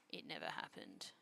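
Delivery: very quiet, low, near-voiceless repeat; hushed denial. Real, not acted. Streamer denying the burnt rice, hushed denial. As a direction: drop it right down under your breath.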